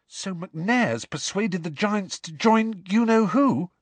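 put-on high, anxious girl's voice; desperate. A narrator's reading. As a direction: ask urgently, dreading a yes. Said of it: "said Hermione desperately".

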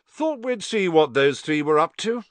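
matter-of-fact. A narrator's reading. Fred explains why the twins turned up, matter-of-fact. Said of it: "said Fred matter-of-factly".

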